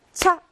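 차 in one short, sudden syllable; 차 as a single quick beat. Shown as a demonstration. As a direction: fire off 차 all at once. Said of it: "한꺼번에 소리를 내야".

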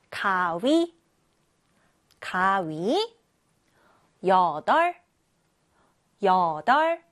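slow, clear word-by-word model reading for class to repeat; patient teacher's read-aloud drill. Shown as a demonstration. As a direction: say each word twice, crisp and deliberate for children. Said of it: "선생님이 큰 목소리로 읽으면 여러분도 따라서".

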